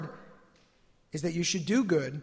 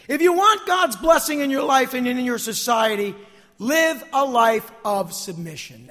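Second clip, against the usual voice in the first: loud exhortation, slowing at the end; emphatic pulpit appeal; forceful preaching; 6 semitones higher, 7 dB louder than usual. Real, not acted. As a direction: urge the congregation with full voice.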